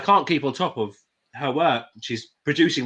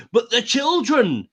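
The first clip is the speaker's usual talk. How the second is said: high, drawn-out mock plea; crusading child-saver; 9 semitones higher than usual. Quoting her to show why people believe, high mock plea. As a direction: imitate an impassioned appeal for the children. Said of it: "because she says".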